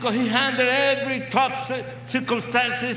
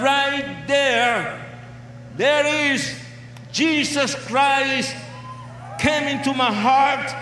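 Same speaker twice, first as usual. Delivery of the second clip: preaching at full force; 4 semitones higher than usual. Real, not acted. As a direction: proclaim it to the crowd.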